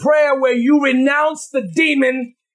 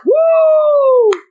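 a loud, high whoop of excitement; a sudden loud, high preacher's whoop; excited woo; 12 semitones higher, 6 dB louder than usual. Real, not acted. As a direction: whoop it out like you've caught the Spirit. Preacher's excited outburst mid-list.

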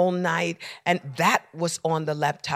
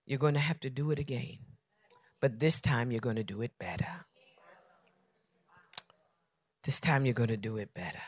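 whisper; 3 semitones lower, 9 dB quieter, faster than usual. Retold or imitated. The Holy Spirit whispering to the pastor, hushed. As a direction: breathe it like a secret promise. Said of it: "the Holy Spirit whispering to me".

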